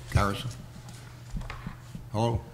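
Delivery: ordinary talk, nothing marked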